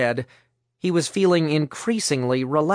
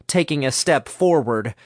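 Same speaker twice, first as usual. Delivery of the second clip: ordinary delivery, nothing marked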